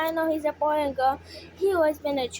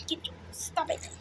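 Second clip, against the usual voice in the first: whisper; 2 semitones higher, 10 dB quieter than usual. Real, not acted. Whispered scolding of pet.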